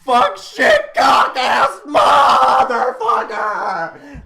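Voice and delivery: high, loud laughing yell of curses, dragging out the last; screaming swears. Retold or imitated. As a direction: rattle off swears in mock panic. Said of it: "they're gonna be screaming".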